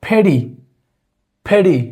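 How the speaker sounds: imitating the ferry vowel as ফেরি; Amir Rekhana's pronunciation. Shown as a demonstration. Teacher demonstrating ফেরি for Bangla learners. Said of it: "আমির রেখানা যদি pronunciation করে".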